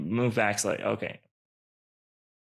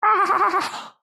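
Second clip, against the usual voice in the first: very high creature wail; high-pitched scream of dismay; anguished cry; 4 dB louder than usual. Acted. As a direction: cry out at a terrible loss. Creature loses the gems.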